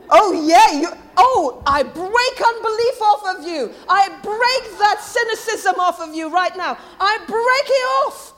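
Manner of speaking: high, loud declaration, building to a forceful cry; vehement. Real, not acted. Preacher breaking unbelief off the congregation, vehement. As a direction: proclaim it with full preaching force. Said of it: "That was vehement".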